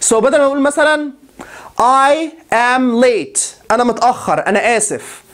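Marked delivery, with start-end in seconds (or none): 1.8-3.4 I am late stretched out word by word; slow, careful teacher's model; very slow model sentence. Shown as a demonstration. Teacher models the sentence slowly for students. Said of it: "model sentence slow".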